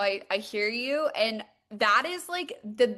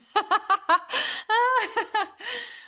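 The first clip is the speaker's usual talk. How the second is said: high rising giggle; tickled by mom; delighted laugh; 11 semitones higher, 3 dB louder than usual. Real, not acted. Host reading mom's chat message, delighted laugh.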